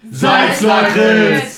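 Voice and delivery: high, loud, drawn-out cheer; excited shout. Real, not acted. Host's friend shouting his pick in the quiz, excited. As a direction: yell the answer with glee.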